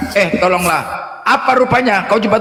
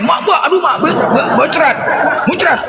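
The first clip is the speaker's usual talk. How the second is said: mock pained yelp; 7 semitones higher than usual. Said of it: "Dihajar lagi congornya".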